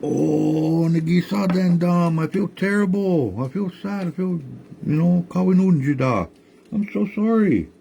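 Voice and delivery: high, regretful tree voice; high remorseful tree; sorry tree voice. Acted. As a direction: apologize as the tree, pitched high. Tree apologizes in story.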